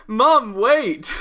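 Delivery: high, loud whiny "Mom, wait"; imitating young man. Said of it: "which means".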